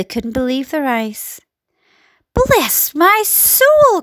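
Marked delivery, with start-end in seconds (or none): 2.3-4.0 high, slow cry of amazement; astonished cry. A narrator's reading. Fire chief seeing a small boy, astonished cry. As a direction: cry it out, stretching each word in disbelief. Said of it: "cried the head of the fire department, going red in the face".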